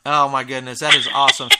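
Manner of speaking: usual speaking voice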